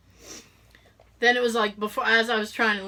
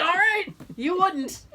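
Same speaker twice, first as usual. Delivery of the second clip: higher, slow, laughing through it; laughing wry acceptance; 8 semitones higher, slower than usual. Retold or imitated. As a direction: give in with a laugh.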